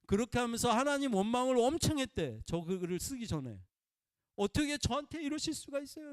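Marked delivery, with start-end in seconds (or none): 4.4-6.1 high, aggrieved plea; retold complaint to God. A woman complaining to God after hours of waiting, higher and aggrieved. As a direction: cry out to God as if wronged. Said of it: "이러면서".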